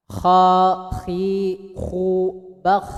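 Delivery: slow "Kha, khi, khu, bakh" with throaty kh; slow recitation demo. Shown as a demonstration. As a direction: say each syllable slowly, kh rasping in the throat. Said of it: "Pelan-pelan". (pelan-pelan, kh dari tenggorokan)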